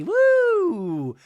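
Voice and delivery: high, loud whoop; cheering woo. Real, not acted. Podcaster cheering Brittany on, high loud whoop. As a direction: let out a happy cheer.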